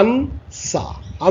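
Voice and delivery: "answer" drawn out in two beats, আন, সা; pronunciation demo. Shown as a demonstration. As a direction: say "answer" very slowly, split in two. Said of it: "আমার সাথে সাথে উচ্চারণগুলো". ("answer" দুই ভাগে টেনে ধীরে)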